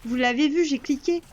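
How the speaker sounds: loud protest breaking into laughter; laughing loudly. Real, not acted. Player laughing to chat about her misclick, loud laughing protest. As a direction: laugh it off at full volume.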